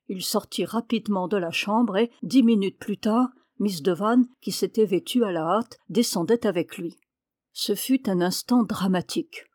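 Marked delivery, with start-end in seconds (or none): none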